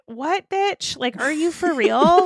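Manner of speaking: high, incredulous "What, bitch?"; a stunned, high-pitched disbelief; shocked. Retold or imitated. To her mom, stunned and disbelieving. Shocked reply to mom's news.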